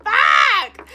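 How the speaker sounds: loud high exclamation; surprised shout; shouted curse. Real, not acted. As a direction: blurt it out loud.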